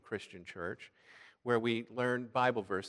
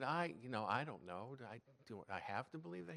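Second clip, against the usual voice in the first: put-on hesitant quiet boy; 7 dB quieter than usual. Boy unsure answering friend.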